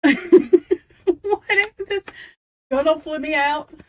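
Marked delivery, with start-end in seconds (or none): 0.0-2.0 slow, high words through real laughter; baffled question dissolving into laughter; laughing, baffled. Real, not acted. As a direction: ask it helplessly through giggles. Laughing, baffled by noise in house.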